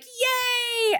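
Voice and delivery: squealed high yay; very high delighted squeal; cheer. Retold or imitated. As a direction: squeal with joy. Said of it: "we're like".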